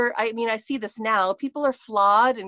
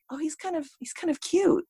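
dreamy realization; 8 semitones higher, 4 dB quieter than usual. Retold or imitated. Same thought retold again.

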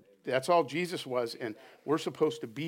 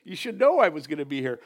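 put-on exasperated kid; 4 semitones higher, 3 dB louder than usual. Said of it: "He's like, kinda like".

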